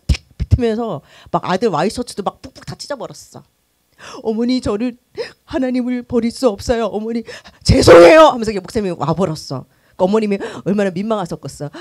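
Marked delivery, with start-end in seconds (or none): 4.2-8.2 louder, earnest plea ending in a loud cry of apology; pleading son. Retold or imitated. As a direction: beg her forgiveness but stand firm. Son refuses his mother.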